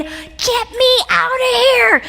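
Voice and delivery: high, slow, drawn-out mock plea; mock panic. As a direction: ham up a terrified whine, way up high. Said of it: "I was saying".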